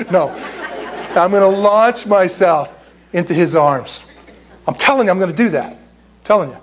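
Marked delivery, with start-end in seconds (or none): none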